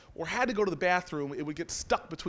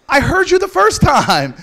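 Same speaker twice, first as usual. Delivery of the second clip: loud, high, stretched-out yell back; exasperated shout; 8 semitones higher, 16 dB louder than usual. Acted. Narrator answering Miss Jones's repeated line, exasperated shout. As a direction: holler it back slowly, fed up.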